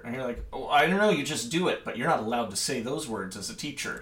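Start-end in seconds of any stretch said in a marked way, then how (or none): 0.5-1.8 higher, louder offhand answer; flustered brush-off. Acted. Teacher dodging a student's question, flustered brush-off. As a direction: toss it off as if caught out.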